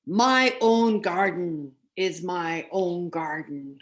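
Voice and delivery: slow, possessive giant voice; stern giant. Acted. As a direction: lay down the law as the giant. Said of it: "said the giant".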